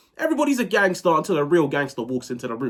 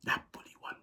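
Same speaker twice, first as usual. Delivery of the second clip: whispered score; 16 dB quieter than usual. Real, not acted.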